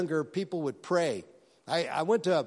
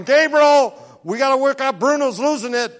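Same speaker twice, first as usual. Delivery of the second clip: mock panic, much higher and louder; panicky God; 9 semitones higher, 11 dB louder than usual. God fretting to Gabriel in heaven, mock panic. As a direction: ham up a flustered God calling for help. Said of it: "God's not in heaven going".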